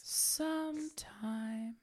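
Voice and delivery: slow whispered echo. Pretend. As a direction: breathe it out slowly. Answers the song softly.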